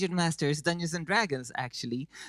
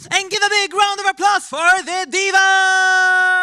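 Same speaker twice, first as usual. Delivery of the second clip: much higher, much louder announcer call; show host announcement; 14 dB louder than usual. Acted. Host calls for applause for the diva, booming announcer. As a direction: whip up the crowd like a game show host.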